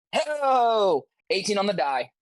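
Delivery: excited. Real, not acted. Sees a high roll.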